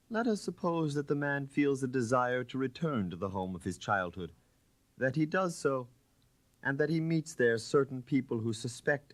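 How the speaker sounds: a hint of a suave Chevalier building his story; Chevalier telling his tale. Floriani tells his story in character.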